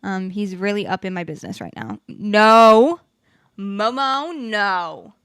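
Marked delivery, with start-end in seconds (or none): 2.1-5.1 loud sudden no at the dog; sharp no. Real, not acted. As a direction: stop the pet with one loud word. Scolding the dog.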